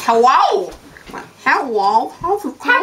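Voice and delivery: lightly voiced buck-toothed talk; buck teeth impression. Shown as a demonstration. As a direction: talk through big front teeth. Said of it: "they talk like this".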